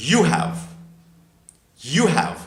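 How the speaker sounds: "you have" as one word, you've, twice; short form of "you have". Shown as a demonstration. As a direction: model "you have" as you've, pausing for repetition. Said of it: "ওটা short form".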